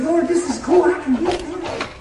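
high, loud, thrilled; a joyful discovery called out; excited. Retold or imitated. Retold prayer, loud and high.

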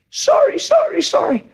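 wife's sorry. Retold or imitated. Preacher imitating his wife repeating sorry, pitched high. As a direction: squeak out her sorries like the wife.

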